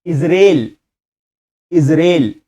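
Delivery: इजराइल as "iz-rel", long e; teacher modelling इजराइल with an "ay" vowel, "iz-rayl"; model pronunciation. Shown as a demonstration. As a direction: say इजराइल as two crisp syllables, IZ-REL. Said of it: "इजराइल नहीं, इजराइल".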